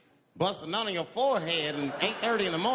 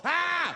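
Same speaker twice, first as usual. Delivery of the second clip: high loud yelp; startled scream; 12 semitones higher, 5 dB louder than usual. Retold or imitated. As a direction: yelp as you dodge. Dodging the shot.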